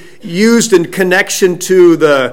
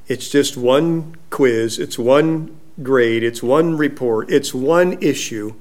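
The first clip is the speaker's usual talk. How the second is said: slow, soft, low reassurance; parent calming a child; 4 semitones lower, 7 dB quieter than usual. Retold or imitated. Parent reassuring a child about one quiz, soft and slow. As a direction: talk the worry down gently, item by item. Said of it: "do that with your children".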